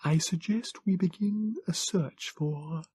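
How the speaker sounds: high, slow, strange Morlock voice; a small, cool, learned creature speaking slowly; calm alien scholar, high and slow. Acted. Morlock voice continues.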